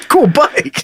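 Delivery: mock chummy mail guy. Mocking the mail guy again.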